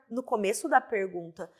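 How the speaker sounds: normal talking voice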